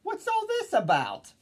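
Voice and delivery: very high-pitched startled crowd cry; high storybook shout of bewilderment. A narrator's reading. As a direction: call it out in astonishment. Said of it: "And the people came shouting".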